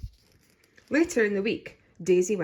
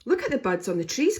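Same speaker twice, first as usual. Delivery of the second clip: cried; 6 semitones higher, 4 dB louder than usual. A narrator's reading. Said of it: "cried Mummy Rabbit".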